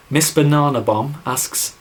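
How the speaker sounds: normal talking voice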